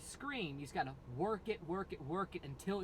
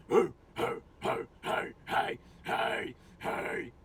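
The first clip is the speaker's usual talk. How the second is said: loud breathy barking screams; dog bark low scream; 7 dB louder, slower than usual. Shown as a demonstration. Demoing low screams by imitating a dog bark. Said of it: "imitating a dog".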